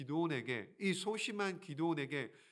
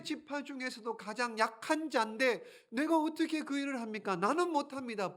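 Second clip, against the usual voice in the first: higher, pleading refusal; higher, whiny refusal; reluctant Gideon; 7 semitones higher than usual. Acted. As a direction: beg off the task.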